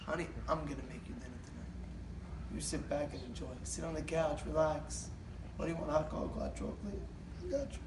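soft, low coaxing; hushed, doting husband voice; tender pampering. Retold or imitated. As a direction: soothe a spouse with gentle offers. Husband pampering his wife.

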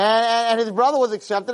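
ordinary talk, nothing marked

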